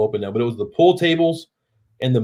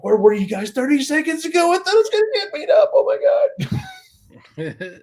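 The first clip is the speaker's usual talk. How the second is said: much higher, whimpering mock cry; mock panicked whine. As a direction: whine it like you nearly got beaten up. Retelling his panic to fellow bouncers.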